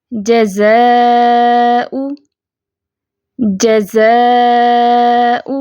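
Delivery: careful Quranic reading; slow tajweed recitation. Shown as a demonstration. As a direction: recite it word by word, stretching the vowels. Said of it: "جھٹکے سے پڑھیں گے".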